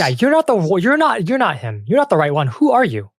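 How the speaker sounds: high, loud, flustered fairy voice; suspicious high-voiced fairy; confused ice fairy.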